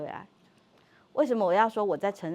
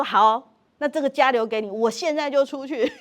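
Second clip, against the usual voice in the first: angry threat to leave; 5 semitones higher, 7 dB louder than usual. Retold or imitated. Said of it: "然后他就说".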